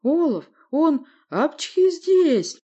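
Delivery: high, loud, slow words broken by a sneeze; drawn-out sneezy shout; sneezing while talking. Acted. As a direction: force the words out around a sneeze. Talking through a sneezing fit.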